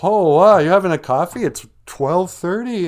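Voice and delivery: a hammed-up coffee snob, aghast; mock shocked. Lunch companion scolds a midday coffee order, mock shock. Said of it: "And they're like".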